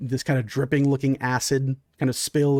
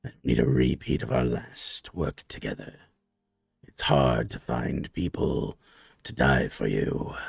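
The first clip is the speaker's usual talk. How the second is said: much lower, scolding and commanding; low softer reprimand; deep stern monster boss; 13 semitones lower, 4 dB quieter than usual. Acted. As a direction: talk down to the brute from deep in the chest. Leader orders the human.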